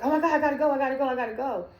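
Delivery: high, loud, frantic repeating; retold panicked scream. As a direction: shout it as if rushing out in panic. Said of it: "I'm screaming".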